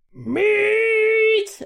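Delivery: long, high, loud hawker's cry; imitated vendor yell. Telling of the Meat Lady selling at the fair, high drawn-out yell. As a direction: yell it across a stadium, drawn out. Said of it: "Who was yelling".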